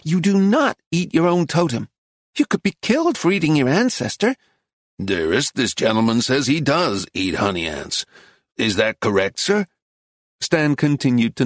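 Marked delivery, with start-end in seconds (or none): 0.0-4.4 high-pitched, insistent wife; shrill warning from a wife; shrill scolding. A narrator's reading. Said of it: "the wife shrilled". 5.0-9.7 measured, contradicting husband; courteous American man's voice; patient American husband. Acted. Reader plays American husband.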